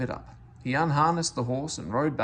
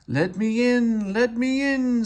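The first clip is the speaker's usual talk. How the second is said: high, louder, slow ghostly moan; spooky ghost voice; 11 semitones higher, 6 dB louder, slower than usual. Acted. Percy pretending to be a ghost at the shed doors, spooky. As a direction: wail it like a ghost at the door. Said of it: "said Percy in a spooky voice".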